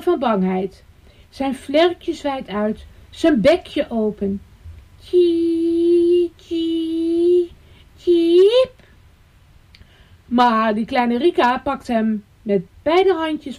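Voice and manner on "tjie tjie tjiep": high trembling cheeps; scared chick. A narrator's reading. Little bird shivering by the fence, frightened chirps. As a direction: peep in fright, pitched high.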